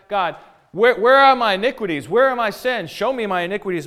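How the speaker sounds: loud, high-pitched demand; bold challenge. Retold or imitated. Job dares God to show him his sins, challenging. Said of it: "Can you imagine saying that to God?".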